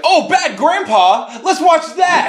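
high, slow, gushing excitement; excited high squeal. Retold or imitated. Friend Bailey begging to watch a comedy, excited squeal. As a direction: squeal it like a thrilled kid. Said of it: "Bailey was like".

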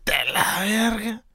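high, hammed-up Mexico City dad ranting, then a mock polite English plea; high mock chilango dad voice; impression of his dad. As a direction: mimic dad cursing the homeowners. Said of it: "spot-on impression".

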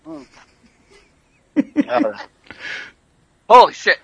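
gagging and groaning; strained groans; choking. Real, not acted. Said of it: "You all right?".

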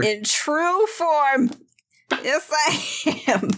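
high, very slow proclamation; drawn-out grand general voice; general's voice. Acted. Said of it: "the general's voice".

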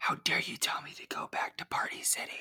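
laughing ASMR whisper; the insult, whispered and giggling; whispered through laughter. Pretend. Mock ASMR fight continues.

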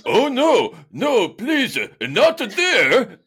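high, loud, slow hammed-up faux French; mock sexy accent. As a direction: coo it like Pepe Le Pew, overdone. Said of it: "what accent that is".

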